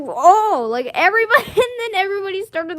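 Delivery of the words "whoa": laughing whoa. Retold or imitated. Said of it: "Everybody just".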